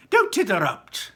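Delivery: high, loud snap; curt rebuke. A narrator's reading. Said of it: "said Mister Wonka".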